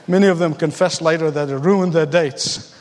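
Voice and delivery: usual speaking voice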